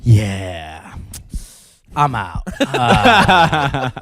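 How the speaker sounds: low, flat bail-out; a lightly voiced buddy leaving; impression of Drago. Drago stuck at a bad hang, dropping his voice to bail. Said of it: "give us a Drago where he ... situation he doesn't love".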